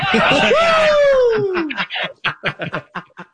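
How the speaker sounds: big high laughing howl. Real, not acted. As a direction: burst out in a shrieking laugh. Reacts to quote.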